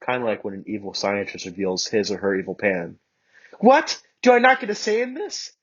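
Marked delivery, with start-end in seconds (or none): 3.6-5.5 high, loud indignant shout; girl shouting her protest at Bakugo. Acted. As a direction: protest loudly. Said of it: "I shouted".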